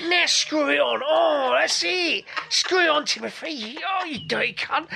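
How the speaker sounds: high silly voice; retelling the film scene, high and daft; film characters. Retold or imitated. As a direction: squeak it like a cheeky film kid. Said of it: "he's like".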